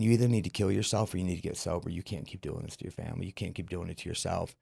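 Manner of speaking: inner thought. Retold or imitated. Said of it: "I remember just thinking".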